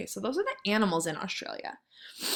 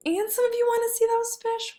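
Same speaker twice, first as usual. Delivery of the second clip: excited; 13 semitones higher than usual. Shown as a demonstration. Teacher reacting to class answers.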